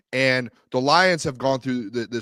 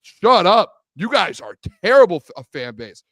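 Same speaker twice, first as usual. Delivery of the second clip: louder and higher, hammed-up disgust at the fans; mock fed-up yell; 4 semitones higher, 7 dB louder than usual. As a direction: shout them down like the whole league is sick of them. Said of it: "the N F L world is like".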